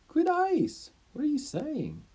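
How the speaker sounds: high, loud startled question; surprised. Acted. Teacher Diodorus reacts to his best student being criticised, startled. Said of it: "he's surprised that the other guy would say this".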